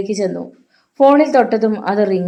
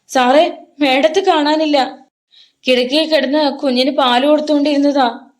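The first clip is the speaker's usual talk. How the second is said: panicked; 6 semitones higher than usual. A narrator's reading. Rosie explains to Hari where madam was, panicked. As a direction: plead it out in distress.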